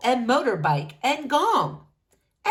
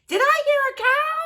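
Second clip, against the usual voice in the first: high, loud quick question; Bean shouting; 7 dB louder, faster than usual. A narrator's reading. Bean mishearing and asking about a cow, high, loud and fast. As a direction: shout out, confused. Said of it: "Bean shouts".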